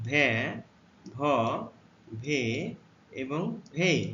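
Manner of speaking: ভে, ভ, ভে, ভেই said one by one, slow and clear; teacher modelling ভে ভ ভে ভেই; letter sounds demo. Shown as a demonstration. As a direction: model ভে ভ ভে ভেই with a pause after each. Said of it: "ভি এর উচ্চারণ".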